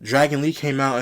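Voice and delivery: ordinary talk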